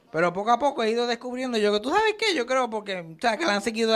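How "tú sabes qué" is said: high squeaky "¿tú sabes qué?"; sudden realization. Real, not acted. Telling the audience he figured it out, high. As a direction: jump way up as if realizing it. Sudden pitch jump aside.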